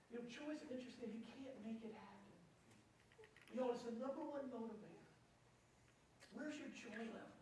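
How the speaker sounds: climbing sermon push; preaching. Acted. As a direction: build it up and urge the congregation on. Preaches to the congregation.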